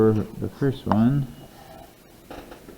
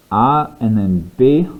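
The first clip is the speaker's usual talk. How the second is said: slow; 5 dB louder than usual. Shown as a demonstration.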